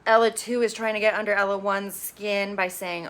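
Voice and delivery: ordinary talk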